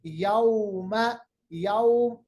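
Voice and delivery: यौम with a soft, slowly drawn au; soft drawn vowel. Shown as a demonstration. Teacher models यौम slowly for students. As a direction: draw out the au of यौम softly. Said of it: "यौम नहीं है अरबी में".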